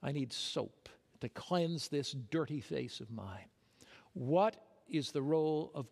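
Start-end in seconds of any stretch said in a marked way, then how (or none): 0.0-3.5 whisper. Real, not acted. Sermon illustration, hushed aside.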